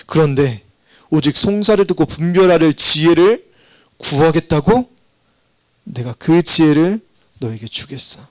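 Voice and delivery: usual speaking voice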